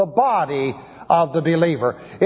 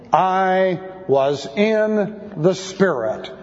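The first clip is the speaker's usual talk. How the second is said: intoned verse; much slower than usual. Shown as a demonstration. Reading the key verse aloud.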